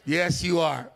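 ordinary talk

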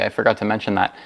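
ordinary talk, nothing marked

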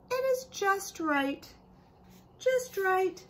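a high, bright sales clerk voice; store clerk voice. Acted. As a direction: pitch up as the cheerful store man. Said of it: "the man says at the store".

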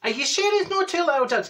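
very high, querulous questioning; doubtful insistence. Acted. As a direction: query it as if unconvinced. Marjorie doubting the correction.

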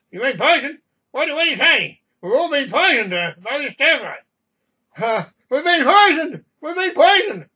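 loud, high-pitched panicked yelling; screaming about poisoning. Acted. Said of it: "tell him to stop screaming about being poisoned".

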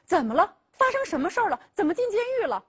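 sudden high, urgent alarm; alarmed questions. Acted. Father hears son jailed, alarmed and urgent. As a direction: fire off shocked questions, voice jumping up. Father shocked by prison news.

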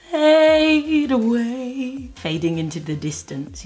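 long, airy glide on each word; drawn-out fade. Shown as a demonstration. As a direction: stretch the words out as if dissolving away. Acting out the brow fading away.

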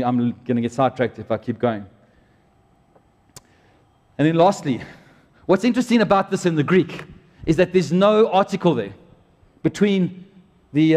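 normal talking voice

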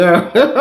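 quick high laugh; short laugh. Real, not acted. Vlogger amused at his own joke to viewers, short laugh.

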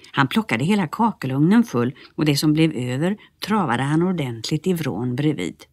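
usual speaking voice

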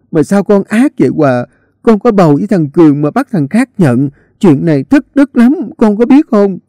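shrill accusation. A narrator's reading. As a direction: lay the blame on thick. Neighbour accuses Hòa shrilly.

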